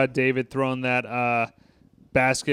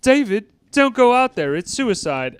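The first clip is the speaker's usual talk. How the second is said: higher, louder mock warning; quoting the line; 8 semitones higher, 4 dB louder than usual. Begging David not to go out, higher and louder. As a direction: warn him like a worried friend. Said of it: "He's like".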